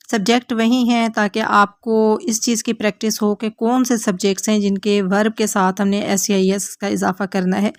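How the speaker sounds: usual speaking voice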